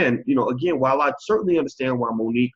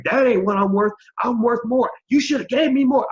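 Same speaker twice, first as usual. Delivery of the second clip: higher, louder mock complaint; mock hollering; 10 semitones higher, 5 dB louder than usual. A worker hollering at a lowballing boss, mock loud. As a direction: holler a pretend demand for more money. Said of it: "I can holler all day".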